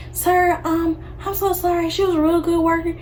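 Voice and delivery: apologetic manager. Retold or imitated. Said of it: "the lady was like".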